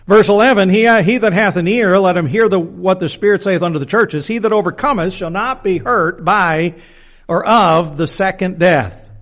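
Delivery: scripture reading. Acted. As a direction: read the verse out forcefully to the congregation. Reads scripture aloud to the congregation.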